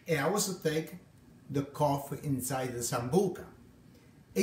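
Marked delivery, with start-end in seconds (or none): none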